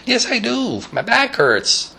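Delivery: high creaky granny reply; old lady voice. Retold or imitated. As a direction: answer like a stiff-backed old lady. Stelta complains of back.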